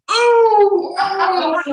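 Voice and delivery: long, high, loud "ooh" whoops; high-pitched mock cheer; imitated cheering whoop. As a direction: mimic a crowd whooping from afar. Imitates friends cheering from above.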